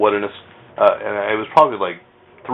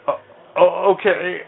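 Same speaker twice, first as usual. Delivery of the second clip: higher, mock dawning realization; realizing; 9 semitones higher, slower than usual. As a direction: act out the penny dropping. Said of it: "I went".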